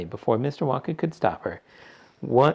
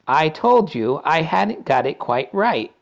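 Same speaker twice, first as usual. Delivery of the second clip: slow, higher, regretful; rueful sigh; 7 semitones higher, 6 dB louder, slower than usual. A narrator's reading. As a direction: remind them regretfully. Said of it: "sighed Mister Wonka, shaking his head sadly".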